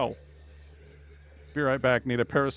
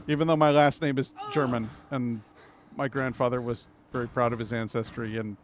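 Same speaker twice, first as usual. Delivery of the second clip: ordinary talk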